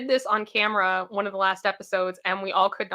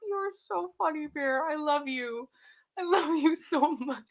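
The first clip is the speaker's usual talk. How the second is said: cooing to dog; 5 semitones higher, 5 dB quieter than usual. Real, not acted.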